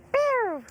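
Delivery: very high, loud mock pew of a light; light flash noise. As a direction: zap a bright beam with a high pew. Imitating a bright light flash.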